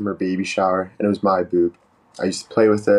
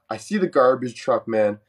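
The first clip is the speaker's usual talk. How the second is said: ordinary delivery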